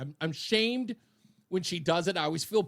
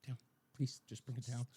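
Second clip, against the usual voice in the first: much lower and softer, pleading; a quiet, lowered plea to tone it down; imitating a hushed plea; 6 semitones lower, 13 dB quieter than usual. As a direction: hush him down like a tired wife. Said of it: "she'll be like".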